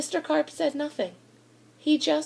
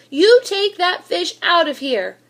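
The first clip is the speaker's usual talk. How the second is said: higher, louder angry yell; 6 semitones higher, 6 dB louder than usual. A narrator's reading. Swimmers yelling at the boy to remove his fish, angry loud yell. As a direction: shout them off. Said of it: "they yelled".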